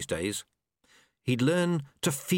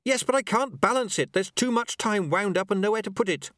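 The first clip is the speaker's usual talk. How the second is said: high, urgent call back; overwhelmed, calling for help; anxious apprentice; 9 semitones higher than usual. Acted. Apprentice who can't balance it, anxious call.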